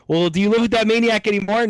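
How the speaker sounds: lightly voiced sceptic teasing a friend; mock retort. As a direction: scoff at the old grudge in a put-on voice.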